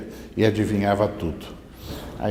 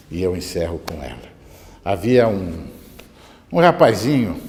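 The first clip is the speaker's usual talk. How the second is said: normal talking voice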